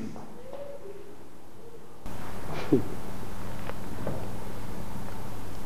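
long breathy high laugh, no voice; scornful laugh. Acted. Mother mocks her son.

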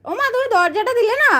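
high playful o ma to the bird; mock surprise. Real, not acted. (উঁচু গলায় মজার অবাক হওয়া)

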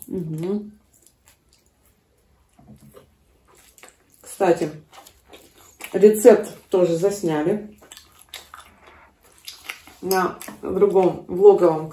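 chewing while talking; talking between bites; mouth full. Real, not acted. Seen 0: Woman bites pancake at 0.5, chews with full cheeks throughout.

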